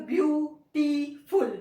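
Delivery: "Beautiful" slowly in three separate, punched parts; split into syllables. Shown as a demonstration. Teacher showing three syllables, "beautiful" said part by part. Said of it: "इसके भी तीन हिज्जे".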